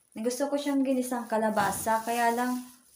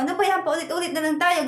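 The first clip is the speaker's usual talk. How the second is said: put-on raised, exasperated scolding of the child, pitched higher; mother retelling how she scolded daughter, exasperated raised voice; retold scolding; 6 semitones higher, 4 dB louder, faster than usual. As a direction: replay scolding the daughter, a bit louder. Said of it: "Sabi ko".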